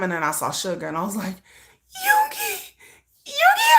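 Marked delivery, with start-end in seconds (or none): 1.3-3.7 very high, crying out his name; excited fangirl scream. Retold or imitated. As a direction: squeal the name like a thrilled fan. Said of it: "I was so excited".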